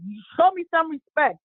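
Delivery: high-pitched demand, not really shouted; retold yelling. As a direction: imitate a cop demanding respect. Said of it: "the cop started yelling some more".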